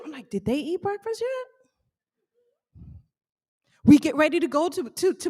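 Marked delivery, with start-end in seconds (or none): 0.3-2.9 high, slow, softer mock bewilderment; puzzled aside. Guest reacting to breakfast greeting, bewildered. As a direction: wonder aloud in a small high voice.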